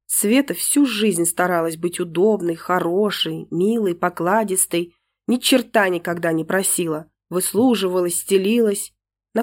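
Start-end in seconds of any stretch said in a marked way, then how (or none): none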